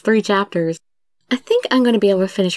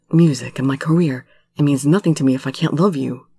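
put-on low-pitched lovelorn young man; earnest Subaru; 6 semitones lower than usual. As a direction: confess love in a lowered character voice. Voices Subaru confessing love.

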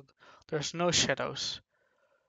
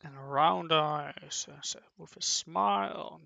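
normal talking voice

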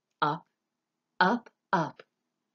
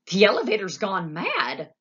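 loud alarmed cry; panicked outburst; frightened shout; 4 semitones higher, 6 dB louder than usual. A narrator's reading. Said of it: "shouted Grandpa Joe".

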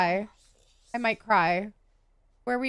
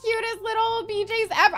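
usual speaking voice

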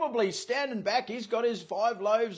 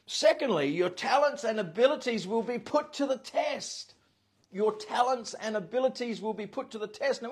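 ordinary talk

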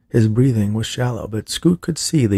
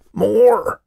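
high, loud scream for more; 13 semitones higher, 5 dB louder than usual. A narrator's reading. Greedy old man screaming at the orderly for more. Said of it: "Old Man Finster screamed".

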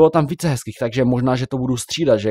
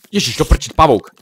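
sudden jolt, louder on "pavouk!"; startled cry; 3 semitones higher, 5 dB louder than usual. Real, not acted. Gamer startled by a spider mid-recording, louder alarmed yelp.

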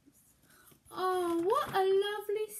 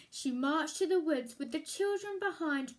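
ordinary delivery, nothing marked

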